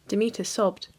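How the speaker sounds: usual speaking voice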